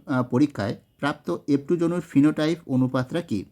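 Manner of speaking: ordinary talk, nothing marked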